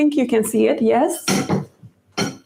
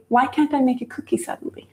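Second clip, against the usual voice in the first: quick, softer frustrated aside to herself; muttered aside; 2 semitones higher, 4 dB quieter, faster than usual. Real, not acted. Host muttering to herself as the cookie won't shape, quick grumble. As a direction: grumble under the breath at the stubborn dough.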